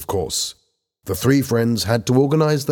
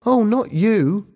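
put-on high-pitched boy's voice; young boy; 13 semitones higher, slower than usual. A narrator's reading. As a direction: answer as a schoolboy, pitched high. Young boy Ben voiced in story.

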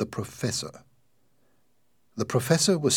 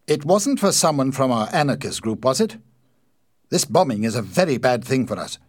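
louder and higher, rising indignation; angrily; 5 semitones higher, 7 dB louder than usual. A narrator's reading. Ossipon angry that the bombing harms the group, raised voice. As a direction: protest hotly against the bombing. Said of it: "Ossipon said angrily".

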